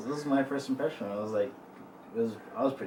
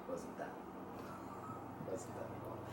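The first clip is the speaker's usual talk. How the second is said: whispered aside; 5 semitones lower, 17 dB quieter than usual. Real, not acted. Softened aside.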